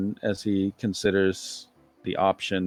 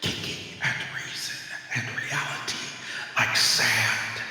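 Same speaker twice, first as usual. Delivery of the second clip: cosmic horse voice; 3 semitones higher than usual. Acted. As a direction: announce yourself like an ancient cosmic beast. Horse MK Ultra keeps talking.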